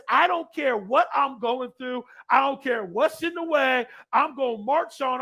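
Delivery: loud, deliberate vow of determination; defiant declaration. Acted. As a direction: proclaim each clause with resolve. Voicing love's defiant declaration.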